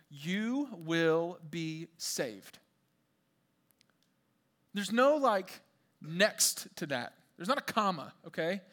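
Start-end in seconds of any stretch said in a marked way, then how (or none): 0.1-2.6 each word drawn far apart; slow emphatic promise. Real, not acted. Scripture promise driven home word by word.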